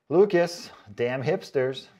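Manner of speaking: normal talking voice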